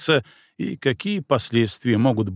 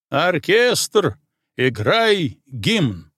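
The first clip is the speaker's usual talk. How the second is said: shouted command; 4 semitones higher, much slower than usual. Acted. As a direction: call it across the parade ground. Colonel commands the band.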